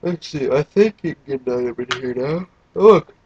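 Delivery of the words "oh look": excited. Real, not acted. Spots two characters on screen.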